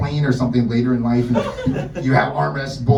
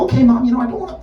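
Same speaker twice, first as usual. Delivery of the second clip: mansplaining self; 8 semitones higher, 3 dB louder than usual. Retold or imitated. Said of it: "And I go".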